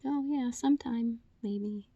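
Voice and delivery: high, slow dodge fading to soft; mock noncommittal. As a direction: imitate someone vaguely putting off plans. Voices people dodging plans.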